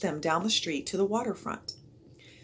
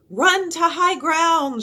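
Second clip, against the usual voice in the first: higher, louder, slow shouted order; 11 semitones higher, 9 dB louder, slower than usual. A narrator's reading. Jack ordering the crowd to high ground, a loud slow shout. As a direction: bellow it at the crowd. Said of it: "shouted Jack".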